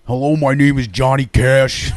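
slow it down like [name]. Pretend. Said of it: "You coulda went".

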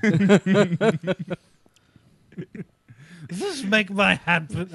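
slow, loud, high put-on accent for the Pope; muddled Pope accent. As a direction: try a German Pope and miss badly. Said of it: "I don't even know what that is".

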